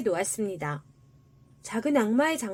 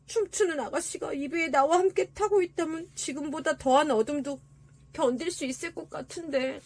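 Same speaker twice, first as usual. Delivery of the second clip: tin soldier musing; 4 semitones higher than usual. A narrator's reading. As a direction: muse aloud as the soldier, fading at the end. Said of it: "양철 군인은 생각했습니다". (아쉬운 듯 살짝 혼잣말하는 양철 군인)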